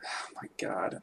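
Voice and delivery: breathy exasperated whisper. Real, not acted. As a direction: whisper it in disbelief. Exasperated at wrestling reference.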